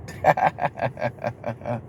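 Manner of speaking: low, quiet run of staccato chuckles; dry, steady ha-ha laugh; chuckling. Real, not acted. Laughs at her retold reply.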